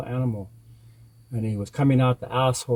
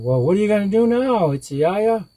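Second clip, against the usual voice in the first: high, quick, raised appeal; anxious people; 9 semitones higher, 4 dB louder than usual. A narrator's reading. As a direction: press the hero urgently, pitched up. People press Coyote again.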